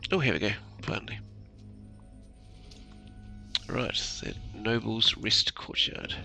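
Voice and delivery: ordinary delivery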